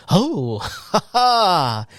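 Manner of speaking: hearty imitated belly laugh, rising high; mock dad guffaw at the hawk. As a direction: mimic the dads' booming laugh. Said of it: "all you hear is the dads go".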